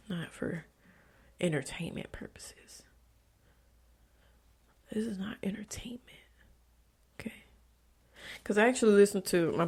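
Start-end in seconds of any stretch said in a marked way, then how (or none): 0.1-7.3 a hushed, breathless whisper; whispered aside. Real, not acted. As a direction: lean in and whisper it like a secret. Whispered aside about the podcast.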